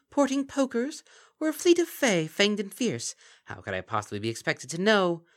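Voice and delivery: high, loud, sly creature voice; mocking Kelpie. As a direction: purr false praise like a gleeful monster. Kelpie playing with alliteration.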